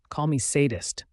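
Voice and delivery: plain reading voice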